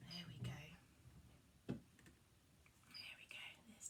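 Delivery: soft unvoiced whisper. Hushing the children as Tinkerbell appears, whispered. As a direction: breathe it so only the front row hears.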